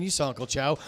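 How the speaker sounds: ordinary delivery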